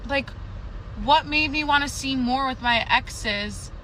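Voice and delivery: ordinary delivery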